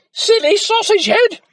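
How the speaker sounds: very high, loud mocking shriek; shouted insult. A narrator's reading. Mother scolding Jack over spilt milk, shrieking. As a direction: yell it at the silly boy. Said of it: "shouted his mother".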